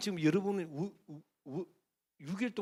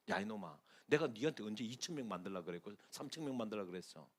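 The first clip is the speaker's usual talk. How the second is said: 부드러운 하나님 음성; 8 semitones lower, 9 dB quieter than usual. Acted. 하나님의 음성을 전함.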